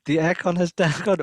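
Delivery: high, loud mock French accent. Reading the French listener's car problem, hammed-up French accent. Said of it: "I wanna see your French accent".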